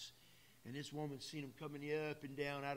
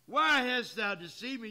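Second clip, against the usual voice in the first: loud, higher cry of alarm; woman's scream; 6 semitones higher, 12 dB louder than usual. Acted. The witch of Endor screaming at the disguised king. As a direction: cry it out like a frightened medium. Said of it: "And the woman screamed".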